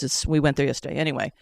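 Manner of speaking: usual speaking voice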